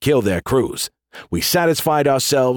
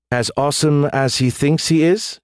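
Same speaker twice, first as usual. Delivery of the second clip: higher, playful question; teasing; 4 semitones higher than usual. A narrator's reading. Officer teasing the general about the AI, playful. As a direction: ask it with a teasing twinkle. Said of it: "she asked with a twinkle in her eyes".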